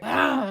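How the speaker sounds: loud guttural cry of rage; re-enacted guttural yell. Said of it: "let out this guttural sound".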